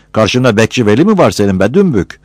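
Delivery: ordinary delivery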